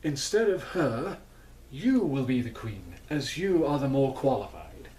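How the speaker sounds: hammed-up royal dad; royal father. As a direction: pronounce it like a king passing judgment. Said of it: "who says".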